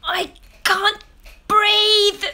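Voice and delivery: high, loud strained gasp; gasping mother. Acted. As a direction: squeeze the words out, breathless. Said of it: "she mouthed".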